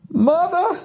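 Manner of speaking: high squeaked mother; comic falsetto mother; mock squeaky surprise. Joking twist word.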